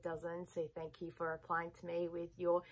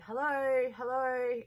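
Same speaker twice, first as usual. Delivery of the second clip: higher, louder imitation of a lady calling out; mock street call; 6 semitones higher, 6 dB louder, slower than usual. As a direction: call out across the street, half-hammed. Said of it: "just yelling".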